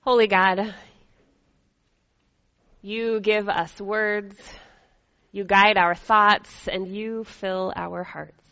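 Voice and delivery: prayer. Acted. Leads an opening prayer.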